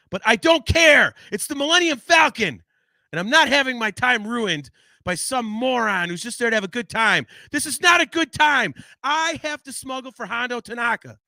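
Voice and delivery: high, loud ranting; mock indignant rant. Entitled fan defending his Falcon ride, loud and high. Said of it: "I'm being an asshole".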